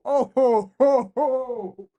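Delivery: high laughing burst; joyful laugh. Real, not acted. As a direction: laugh out with delight. Laughing after the goal.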